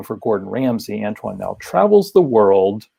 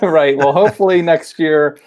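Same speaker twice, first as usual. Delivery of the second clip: laughing reply, pitched up and louder; amused laughing; 6 semitones higher, 5 dB louder than usual. Real, not acted. Host answers guest's travel quip, amused and laughing.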